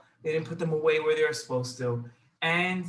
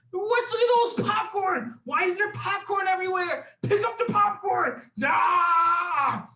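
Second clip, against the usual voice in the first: very high, loud screamed scolding; angry screaming; 12 semitones higher, 6 dB louder than usual. Acted. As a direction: scream at the kids like a mom losing it. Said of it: "She started screaming at them".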